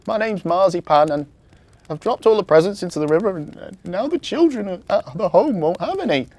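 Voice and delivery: high, loud, wailing elf voice; tearful elf. A narrator's reading. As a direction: blurt out the trouble as an upset elf. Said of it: "she cried".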